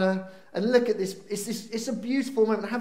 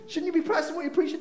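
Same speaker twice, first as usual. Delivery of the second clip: fast, higher pretend accusation; rushed imagined jab; 8 semitones higher, much faster than usual. Imagined child rebuking dad, fast and high. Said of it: "No, she didn't say that".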